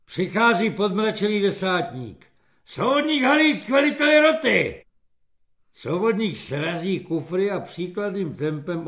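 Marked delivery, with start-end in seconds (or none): 2.6-4.8 high, loud summons; called-out military order. A narrator's reading. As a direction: summon the corporal to the commander. Corporal summoned by sergeant's order. (hlasitý vojenský rozkaz)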